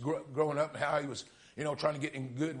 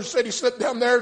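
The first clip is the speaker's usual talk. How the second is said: high, loud preached line; preaching refrain again; 10 semitones higher, 12 dB louder than usual. Acted. Preacher repeating to his listeners, preaching refrain. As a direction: drive the refrain home once more.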